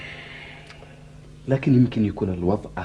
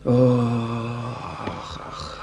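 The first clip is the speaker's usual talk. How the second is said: weary groan; 4 dB quieter, much slower than usual. Acted. Weary sigh of despair.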